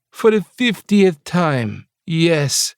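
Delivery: high, slow Jamaican lilt, exasperated; a bossy Jamaican chef losing patience; Jamaican accent. Acted. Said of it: "her accent similar to my mother's".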